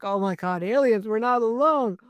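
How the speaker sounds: mildly hammed-up panic, pitch rising; mock freak-out. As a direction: imitate people freaking out over aliens. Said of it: "people are freaking out like".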